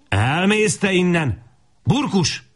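high, drawn-out scolding cry; angry order. A narrator's reading. Woman chasing boys off and calling her dog, angry cry.

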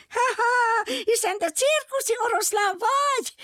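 high laughing squeal of a little monkey. Acted. Monkey squeals with laughter at the circus lion. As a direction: shriek it out, a delighted monkey. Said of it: "visította a majmocska".

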